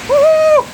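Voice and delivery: high, loud whoop; joyful whoop. Real, not acted. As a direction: cheer at full voice. Celebrating the rain.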